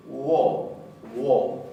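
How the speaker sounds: "wo" said with a small u glide before the o; Russian-like o. Shown as a demonstration. Teacher demonstrating the Icelandic o to students. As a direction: round into "wo" with a tiny u first. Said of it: "se pronuncia un poquito como en ruso".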